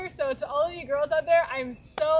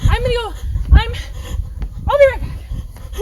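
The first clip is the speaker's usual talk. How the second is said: flustered call; 8 semitones higher, 10 dB louder than usual. Acted. Babysitter rushing in storm.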